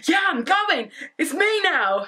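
finally my turn, light and eager; eager relief. Retold or imitated.

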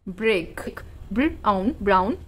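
ordinary talk, nothing marked

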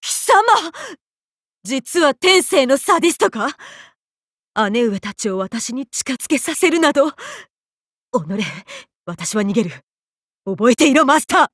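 high-pitched, indignant outburst; flustered outrage. Acted. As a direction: lose your haughty composure and flee protesting. Flustered outburst, then fleeing. (うろたえて憤慨する甲高い声)

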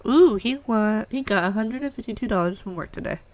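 normal talking voice

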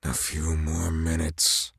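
low, slow musing to himself; inner thought. Acted. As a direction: think it aloud under the breath. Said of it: "Volstagg thought".

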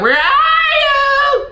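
high, drawn-out granny call; spooky granny call. Retold or imitated. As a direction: call out slowly like a creepy old granny. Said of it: "She said".

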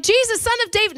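high, loud call for Jesus. Retold or imitated. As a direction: shout for Jesus to notice. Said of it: "He's like".

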